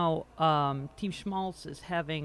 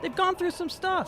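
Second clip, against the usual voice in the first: mock solemn high; 11 semitones higher than usual. Repeated mock solemn remark.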